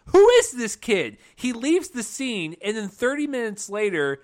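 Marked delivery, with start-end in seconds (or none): 0.1-1.2 loud, high cry of bafflement; mock exasperated outburst. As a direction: demand it loudly, as a baffled viewer. Mock exasperated question about film.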